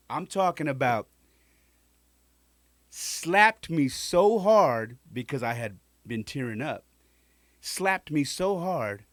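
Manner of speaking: reliving the slap, loud and drawn out; worked-up retelling. Real, not acted. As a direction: make them feel the slap.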